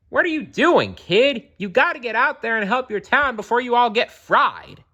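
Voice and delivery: high, loud, exasperated scolding; scolding mayor. Acted. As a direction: tell the kid off in a rush. Mayor scolds idle Jojo.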